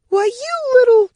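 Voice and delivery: very high, loud indignant squawk; shrieked, cut-off insult; outraged outburst. A narrator's reading. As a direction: explode at him, pitched right up. Threatened rival starts to explode.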